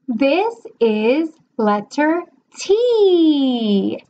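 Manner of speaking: very slow, stretched sentence; slow teacher model. Shown as a demonstration. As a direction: say each word with deliberate slowness. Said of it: "sentence model demo".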